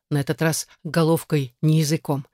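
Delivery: ordinary delivery, nothing marked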